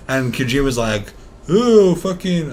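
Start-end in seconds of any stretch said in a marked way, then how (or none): 1.5-2.5 put-on Japanese accent. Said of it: "I was about to do a Japanese accent".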